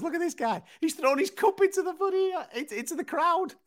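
very high, giggling mock Rogan; laughing Rogan. Rogan laughing at Lewis throwing his cup, very high and giggly. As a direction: squeak it out through laughter. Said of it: "He was just laughing his head off".

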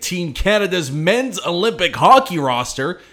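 loud, upbeat show teaser; hyped podcast intro. Acted. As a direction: punch it like an opening hook.

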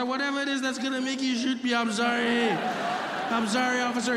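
high, pleading mock wail; panicked apology. Driver fears being shot by officer, panicked apology. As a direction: act out a terrified driver begging. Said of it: "I completely panicked".

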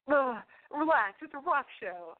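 slow, drawn-out eye-roll "ugh"; dismissive attitude. Retold or imitated. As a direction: brush her off with scorn. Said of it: "I went".

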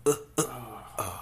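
short soft pretend sex grunts; mock pleasure grunts. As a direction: grunt softly, faking bedroom effort. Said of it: "you just like".

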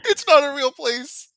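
loud, laughing through the words; cackling while talking. Real, not acted. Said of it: "[name] is cackling maniacally".

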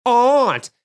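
high, snooty correction; hammed-up pedant correcting aunt to ahnt; mocking a pedant: "aunt" turned to ahnt. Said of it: "and you're like".

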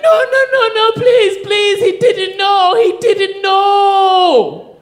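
high, loud desperate begging; frantic pleading. Retold or imitated. Natasha begging the guard to spare him, frantic. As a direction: plead for his life, voice high. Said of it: "she's like".

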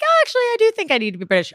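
high, rapid mock star reply; breezy celebrity insistence. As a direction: rattle it off high, airily insistent. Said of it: "It's like".

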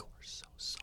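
barely audible whisper; imitating friends' secret apology; mouthed apology. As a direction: whisper it behind someone's back. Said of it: "her friends were behind her like".

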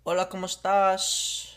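put-on Spanish, stretched out; Spanish accent. Player giving an accent clue to a guesser, slow Spanish accent.

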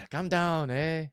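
high-pitched, badly faked French accent; terrible French accent. Podcaster mimicking a footballer calming teammates, in a bad French accent. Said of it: "that's a terrible French accent".

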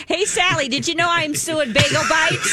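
high-pitched, eager mock busybody; pestering busybody. Woman cornering Sally in the grocery aisle, eager pestering. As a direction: call out like an oversharing acquaintance.

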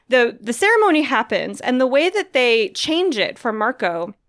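ordinary delivery

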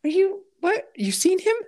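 high-pitched mock disbelief; exaggerated, squeaky-high incredulity; mock incredulous. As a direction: question it as if you can't believe your ears. Mock disbelief at bad grammar.

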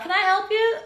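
high-pitched customer-service voice; put-on high server voice; drive-thru worker.

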